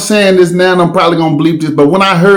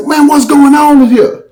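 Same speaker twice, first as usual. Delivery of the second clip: retold alarmed question; 10 semitones higher than usual. O G Mane bursting in on the fight, mock alarm. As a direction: voice the partner bursting in.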